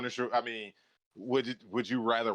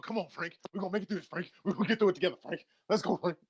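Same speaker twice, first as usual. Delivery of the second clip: Lou Gossett Junior; 7 semitones higher, faster than usual. Retold or imitated. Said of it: "it had Lou Gossett Junior in it going".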